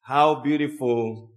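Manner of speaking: ordinary delivery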